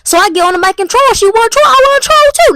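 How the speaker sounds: rising, loud, gleeful; gleeful mischief. Real, not acted. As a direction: build up the trolling excitement. Deciding to troll the program.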